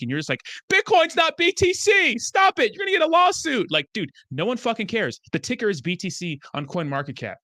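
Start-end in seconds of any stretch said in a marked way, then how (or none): 0.7-3.7 whiny mock complainer. Mocking viewer complaining about BTC.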